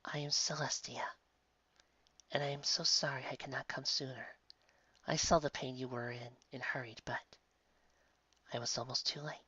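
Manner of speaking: soft, breathy kind angel; an angel soothing the girl in a whisper; gentle angel whisper. Acted. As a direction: whisper comfort to a dying child. Angel speaks gently to dying girl.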